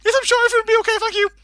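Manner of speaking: frantic falsetto yell; high-pitched scream. Retold or imitated. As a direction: shriek it out in a high voice. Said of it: "screaming in a high-pitched voice".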